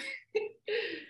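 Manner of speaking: short laugh, very high; sudden laugh. Real, not acted. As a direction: burst out laughing at the Jane Fonda joke. Laughs at the Jane Fonda joke.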